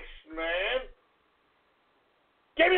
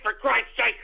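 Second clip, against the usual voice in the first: ordinary talk, nothing marked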